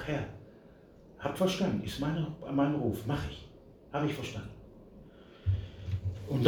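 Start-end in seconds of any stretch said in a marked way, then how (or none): 0.1-4.5 soft, choked answer to God; a hint of weeping, yielding; tearful prayer. Weeping by the church in Munich, answering the Lord softly. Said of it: "hab nur noch bitterlich geweint".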